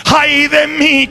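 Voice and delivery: very slow, high crying lament; anguished wail. Acted. Isaiah before the King, wailing in anguish. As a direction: draw out Ay de mí as a sob. Confessing sins as the congregation.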